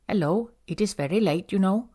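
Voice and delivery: put-on warm, lilting Indian-accented English. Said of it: "He speaks English with an endearing Indian accent".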